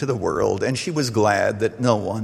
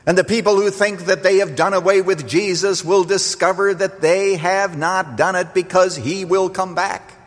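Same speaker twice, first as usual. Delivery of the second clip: raised, high sermon warning; fervent preaching; 6 semitones higher than usual. Acted. Preacher warning those who reject Jesus, fervent preaching. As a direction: preach judgment in a high, driving run.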